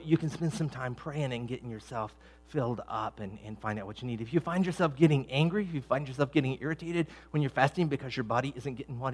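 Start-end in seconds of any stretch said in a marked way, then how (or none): none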